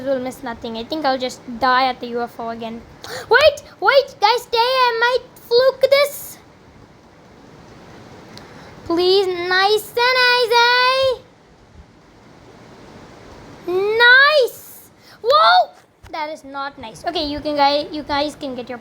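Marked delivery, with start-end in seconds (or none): 3.3-11.2 high, breathless hope during play; high, stretched-out pleading; tense excitement. Real, not acted. Might fluke the hard part. 13.7-15.7 high, loud exclamation; high-pitched yelp of reaction; startled excitement. Real, not acted. Reacting to the risky run.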